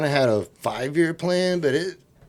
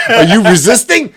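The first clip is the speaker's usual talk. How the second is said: loud, high, gleeful challenge; excited cop; 10 semitones higher, 12 dB louder than usual. Retold or imitated. Cop eager to slam the suspect, excited shout. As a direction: shout it eagerly, hoping for a yes. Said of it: "he was excited".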